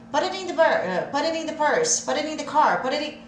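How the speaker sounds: quick "put it in the purse", flapped and run together; fast native speech. Shown as a demonstration. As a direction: rattle it off like a native. (rápido, tudo emendado)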